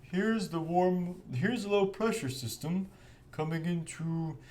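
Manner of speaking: high, soft, drawn-out forecaster voice; TV weather presenter. Acted. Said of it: "sit in front of a T V and be like".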